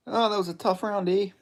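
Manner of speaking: put-on high, lightly delivered Canadian accent; a Canadian hoser conceding between rounds; Albertan hoser. Gamer voicing his fighter in the corner, high Canadian accent. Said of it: "I'm doing that voice for this guy".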